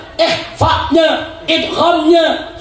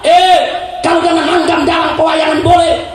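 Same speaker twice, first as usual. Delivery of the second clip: forceful preaching; 5 semitones higher than usual. Real, not acted. Preacher warning the crowd of chaos, forceful preaching. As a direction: preach it high and insistent.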